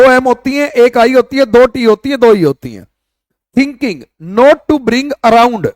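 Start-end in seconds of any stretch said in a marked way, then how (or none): 3.6-5.7 slow dictation. Shown as a demonstration. Teacher re-reading the phrase.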